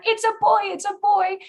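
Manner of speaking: squealed way up high, excited; excited announcement. Retold or imitated. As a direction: cry it out thrilled and high-pitched. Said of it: "excitement coming from behind".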